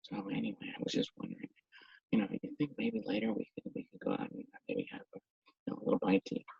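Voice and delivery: hushed, barely audible chatter; casual small talk sunk almost to a whisper; far too quiet. Shown as a demonstration. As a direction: mumble it so softly no one could catch it. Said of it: "if I'm talking to you and I go".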